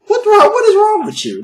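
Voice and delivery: high-pitched, loud hammed-up outburst; mock outraged scolding. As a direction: yell at the listener in mock disbelief. Mock scolding of the email writer.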